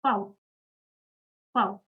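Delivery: "Pau" as German V, fow; German letter name. Shown as a demonstration. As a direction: say "Pau" as fow, rhyming with cow. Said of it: "is pronounced as".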